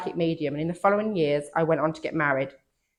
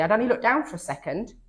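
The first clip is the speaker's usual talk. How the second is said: ordinary talk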